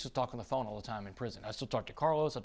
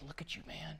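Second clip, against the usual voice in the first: whisper; 6 semitones higher, 10 dB quieter than usual. Retold or imitated. Cellmate's quiet intense remark.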